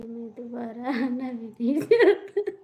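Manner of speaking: reading the line while breaking into laughter; laughing. Real, not acted.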